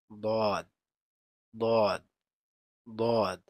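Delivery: very loud, heavy দদ from the tongue's edge; emphatic dad. Shown as a demonstration. Teacher announcing the letter দদ, very loud. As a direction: press দদ hard, tongue edge to the gums. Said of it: "দদ উচ্চারিত হয়".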